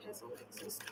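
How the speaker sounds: very soft murmur, slowing down; murmured card question. Real, not acted. Tarot reader asking the cards about spells on a client, murmured. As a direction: murmur the question under your breath.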